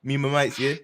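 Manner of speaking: put-on London roadman voice, playful; mock roadman accent. Teasing his friends as a London roadman, mock accent. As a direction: lean into the London slang, half laughing. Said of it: "We ain't doing the U K voices tonight".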